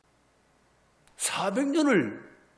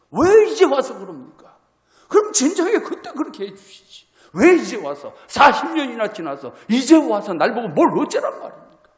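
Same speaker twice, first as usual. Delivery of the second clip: Moses' loud protest; 2 semitones higher, 7 dB louder than usual. Retold or imitated. Moses confronts God, why call me only now, loud protest. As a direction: throw the complaint back at God. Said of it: "막 항변하는 거예요".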